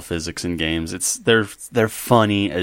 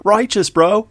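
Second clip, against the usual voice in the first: higher Californian surfer dude, stretched out; Californian surfer accent; 11 semitones higher, slower than usual. Pretend. Joking about his Australians in a Californian surfer accent. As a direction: Californian surfer giving a lazy approval. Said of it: "Californian surfer dude accent".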